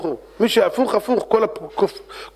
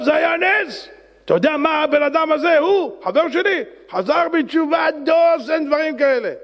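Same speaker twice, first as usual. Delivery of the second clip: excited shout; 9 semitones higher than usual. Acted. Driver excitedly tells the rabbi of the miracle, high shout. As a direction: exclaim it like a miracle.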